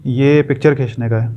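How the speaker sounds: ordinary delivery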